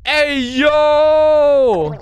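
loud high shout. Real, not acted. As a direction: yell it out. Shouting at the door.

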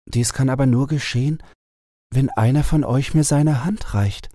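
the enchanted owl telling her sad tale; owl princess voice. A narrator's reading. Owl states her condition. (traurige Eulenprinzessin, etwas höher)

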